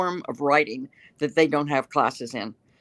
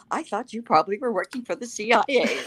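old lady, lightly voiced; 6 semitones higher than usual. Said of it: "she said".

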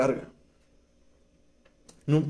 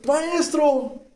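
high, stretched call to the master; hammed-up high wail; mock cry out; 9 semitones higher than usual. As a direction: act out a dismayed cry, pitched high. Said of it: "¿Qué dijo este cuate?".

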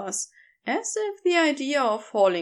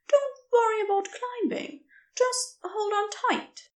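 higher, lighter voice for the kind stranger; gentle rescuer voice; 11 semitones higher than usual. Acted. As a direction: offer your name brightly, a little quicker. Narrator voices Aziraphale.

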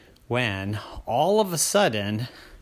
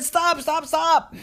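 put-on high, louder pleading girl's voice; 5 dB louder, slower than usual. Crystal begs the burning light to stop, high and loud. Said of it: "And she said".

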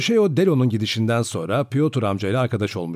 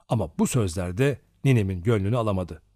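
ordinary delivery

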